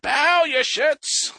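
slow, high, drawn-out royal decree; megalomaniac rant. Pretend. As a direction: lord it over them like a mad king. Echoing the king's line.

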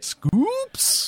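high squeak of disbelief; squeaky surprised echo. Real, not acted. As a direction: pitch way up on the question. Echoing the segment name.

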